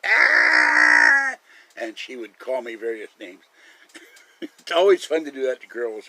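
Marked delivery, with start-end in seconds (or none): 0.1-1.4 a low, loud mock scream as if racing downhill; loud imitated scream with a distorted voice. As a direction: fake Kathy's scared shriek, low and hammed-up. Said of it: "watch Kathy scream".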